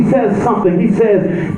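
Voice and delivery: ordinary talk, nothing marked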